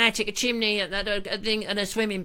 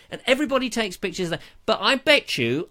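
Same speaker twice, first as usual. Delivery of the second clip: ordinary talk, nothing marked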